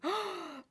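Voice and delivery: high sharp delighted gasp; quick high intake of breath; excited gasp. Acted. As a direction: gasp with thrill at the news.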